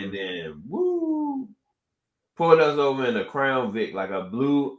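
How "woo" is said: high, sustained "woo" siren; long, high wail like a squad car; imitated siren. Imitates police car pulling up.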